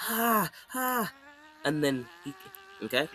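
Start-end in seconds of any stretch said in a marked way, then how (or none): 0.1-1.1 two high acted huffs; mock sprint panting. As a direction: puff like a sprinter, playfully. Imitates the sprinting son.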